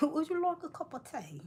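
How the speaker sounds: high-pitched mock British accent; hammed-up British, pitched high. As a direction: offer it in an exaggerated British accent. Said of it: "I go back to British".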